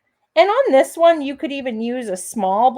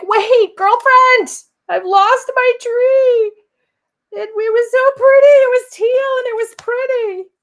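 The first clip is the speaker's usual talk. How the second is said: high, loud mock wail over a lost tree; whiny panicking crafter; 12 semitones higher, 8 dB louder than usual. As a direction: play the upset viewer, much higher and louder, half crying. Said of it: "And you're like".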